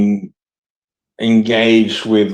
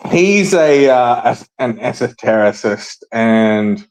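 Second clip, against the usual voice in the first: ordinary talk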